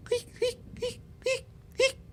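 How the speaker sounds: high, rubbery squeaky-toy squeak; rat squeaks. Retold or imitated. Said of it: "he'll be like".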